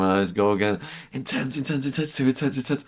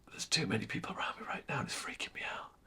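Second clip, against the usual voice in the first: a fast, breathless whisper; hushed panic in a crowded tent; frightened whisper; 7 semitones higher, 15 dB quieter, faster than usual. Retold or imitated. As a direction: whisper it like you're afraid to be heard. Overwhelmed, whispering his fear.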